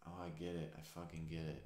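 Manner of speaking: retold awe, low and soft; hushed realization. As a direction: let the tension go, quietly reliving the realization. Reenacts relaxed realization after clenching.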